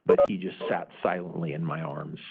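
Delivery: usual speaking voice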